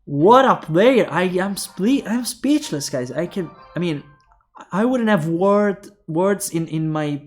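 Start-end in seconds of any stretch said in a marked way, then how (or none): none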